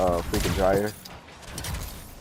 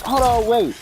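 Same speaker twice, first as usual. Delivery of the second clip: high, loud urgent mom; high mom rushing off; 12 semitones higher, 3 dB louder than usual. Retold or imitated. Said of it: "She was like".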